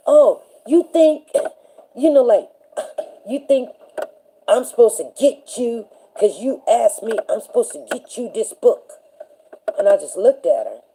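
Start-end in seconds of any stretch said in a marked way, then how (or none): 0.1-8.9 mocking teacher. Substitute teacher ridiculing student asking for book, mocking. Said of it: "She was like".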